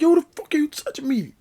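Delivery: very high-pitched, strained mock outrage; squeaky, worked-up retold protest; upset friend. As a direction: imitate an indignant friend, voice shooting way up. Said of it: "he starts to get upset".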